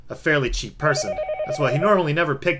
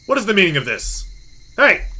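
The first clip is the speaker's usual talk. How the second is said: raised voice building to a shout; alarmed demand; 5 semitones higher, 4 dB louder than usual. Acted. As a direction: demand an answer, then call out sharply. Alarmed by drawer's contents.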